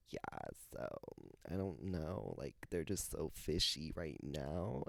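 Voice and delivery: put-on drawn-out Cali girl with swooping pitch; Cali girl accent. Podcaster imitating a reality star she loves, Cali girl accent. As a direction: imitate a Cali girl's slow, stretched cadence. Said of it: "it's like a Cali girl accent".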